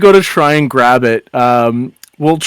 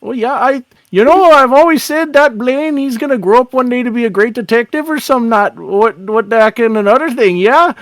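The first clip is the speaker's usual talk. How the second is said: small-town lilt, losing the thread; folksy Irish-Canadian officer, rambling; 9 semitones higher than usual. Acted. Town officer praising boy's future, folksy rambling. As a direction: ramble amiably in a singsong officer voice.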